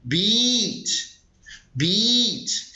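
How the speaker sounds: "Beach" said slowly and clearly, a long ee, for students to repeat; teacher models "Beach" for the class to copy; careful pronunciation. Shown as a demonstration. Teacher drills "Beach" with students, slow and careful. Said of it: "pronuncia essa palavra aqui comigo".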